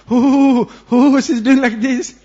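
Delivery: mock shuddering gasps of pain, loud and high; half-laughing poisoned groans; trembling hoo hoo. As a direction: act out trembling under poison.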